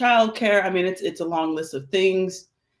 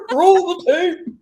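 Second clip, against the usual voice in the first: put-on British old person voice; 13 semitones higher, 6 dB louder, slower than usual.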